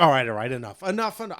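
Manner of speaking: mock exasperation, pitch climbing on the repeats; fed-up protest. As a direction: act out throwing in the towel. Acts out everybody giving up pretending.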